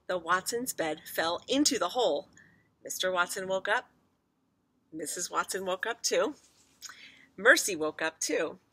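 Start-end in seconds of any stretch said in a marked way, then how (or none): none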